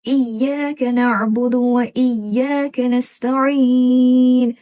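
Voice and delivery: very slow, sharp ain in नअबुदु and नस्तईन; sharp ain recitation. Shown as a demonstration. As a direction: recite slowly with a crisp ain. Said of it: "आइन sharp हो गया". (तेज़ ऐन के साथ धीमी तिलावत)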